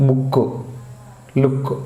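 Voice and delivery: బుక్, లుక్ each with a short oo; short vowels. Shown as a demonstration. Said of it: "short ప్రొనౌన్సియేషనే". (బుక్, లుక్ లో పొట్టి ఊ శబ్దం)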